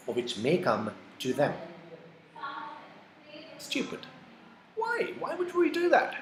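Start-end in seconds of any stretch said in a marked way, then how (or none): 3.6-6.2 high mocking why; mock disbelief. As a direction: jump way up high in scornful disbelief. Mocking a foolish student reaction.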